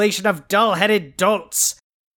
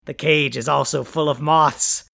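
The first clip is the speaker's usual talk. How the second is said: ordinary talk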